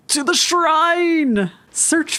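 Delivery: slow, drawn-out high call to the shrine; rallying call. Real, not acted. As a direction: sing it out like a battle cry. Calling out a travel destination.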